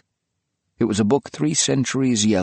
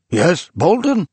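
high, loud sharp query; startled answer; 9 semitones higher, 5 dB louder, slower than usual. A narrator's reading. Old man woken by phone, high loud query. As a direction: snap awake into the phone.

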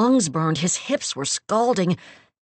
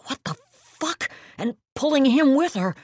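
high, frightened outburst; terrified; 9 semitones higher, 4 dB louder than usual. Acted. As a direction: panic rising in the voice. Terror as he sinks.

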